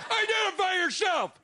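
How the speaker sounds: high demanding call; commanding shout. Acted. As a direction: shout it as a challenge. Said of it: "said Peter Karamazov".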